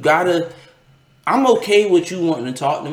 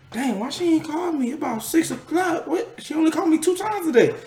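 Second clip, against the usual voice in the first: much higher, wondering aloud; puzzled disbelief; 4 dB quieter than usual. Retold or imitated. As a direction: pitch way up, baffled. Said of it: "I was like".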